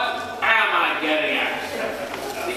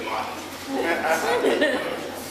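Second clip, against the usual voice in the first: high embarrassed laugh; sheepish high laugh; 12 semitones higher, 4 dB quieter than usual. Real, not acted. Embarrassed at own ramble.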